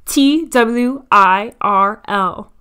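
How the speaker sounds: slow, letter by letter, each one punched; spelled out. Shown as a demonstration. Podcaster spelling the word she can't say, deliberately slow. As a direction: spell it out loud and very slowly.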